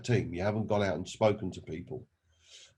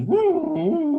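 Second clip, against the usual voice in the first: long, high, loud dog howl; 7 dB louder than usual. Retold or imitated. Dog on the porch interrupts the men with a long howl. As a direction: howl like an old hound, drawn out. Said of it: "the dog goes".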